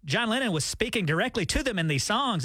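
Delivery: usual speaking voice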